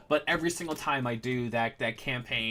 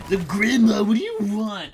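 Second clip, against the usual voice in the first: loud, booming musclebound grunt; muscle man voice; 7 semitones higher, 10 dB louder than usual. Retold or imitated. DM voicing the shop grandson, loud muscle man boom. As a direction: bellow it like a big dumb muscle man. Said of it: "given him a muscle man voice".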